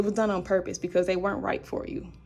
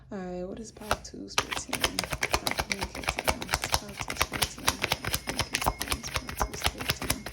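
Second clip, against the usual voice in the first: muttering to herself; 3 dB quieter than usual. Real, not acted. Mumbling card reading to herself.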